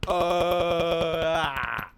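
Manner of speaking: long loud high holler; loud drawn-out "uhhh-ah" yell; chest-pounding yell. Real, not acted.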